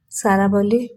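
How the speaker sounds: usual speaking voice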